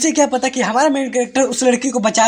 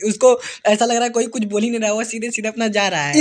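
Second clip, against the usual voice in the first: usual speaking voice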